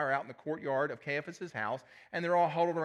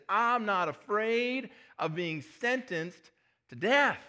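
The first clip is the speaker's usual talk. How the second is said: bold boast; 7 semitones higher, 5 dB louder, much slower than usual. Acted. Peter boasts loyalty.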